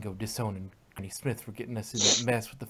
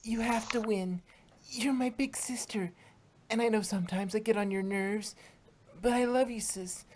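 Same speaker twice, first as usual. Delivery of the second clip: put-on much higher young filly voice, tearful love; little sister pleading; 11 semitones higher than usual. Sweetie Belle hugging Rarity, pleading, much higher. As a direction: beg your sister to hold on.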